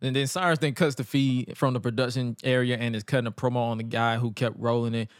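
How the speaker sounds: ordinary delivery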